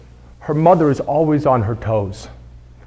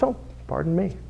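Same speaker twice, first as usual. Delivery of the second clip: polite pardon me; 11 dB quieter than usual. Shown as a demonstration. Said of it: "The right thing to say is".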